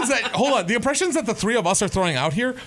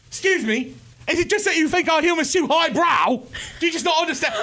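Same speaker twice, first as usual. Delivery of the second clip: high, indignant mock British accent; angry British gentleman; 9 semitones higher than usual. Audience member confronting the panel, angry British accent. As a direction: imitate an affronted Briton, pitched up. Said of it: "from an angry British gentleman".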